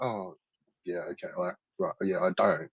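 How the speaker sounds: sarcastic mumble. Retold or imitated. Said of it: "And it's like".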